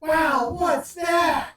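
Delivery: put-on high, loud Southern kid voice; excited little boy, Southern accent. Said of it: "In a very southern accent".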